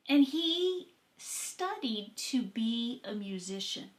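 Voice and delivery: ordinary talk, nothing marked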